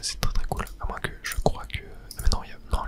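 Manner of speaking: ordinary talk, nothing marked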